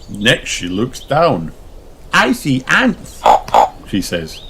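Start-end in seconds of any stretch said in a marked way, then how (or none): 2.1-3.2 high, bright little pig voice; high child voice; Peppa's voice. Acted. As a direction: play the excited piglet. Peppa's lines in a children's story.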